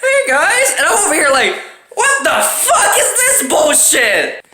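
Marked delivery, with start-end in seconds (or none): 0.0-0.8 high, dreamy, relaxed "hey guys"; mock-blissful girlfriend voice; blissed-out girlfriend. Girlfriend voiced after relaxing massage. 1.9-4.4 high, slow, laughing disbelief; laughing mock outrage; incredulous outrage. His outraged reaction retold.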